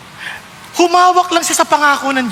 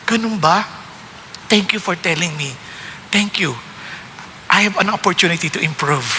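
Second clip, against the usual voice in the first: gentle grateful reply; 7 semitones lower, 6 dB quieter than usual. Retold or imitated. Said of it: "pag sinabi sa atin".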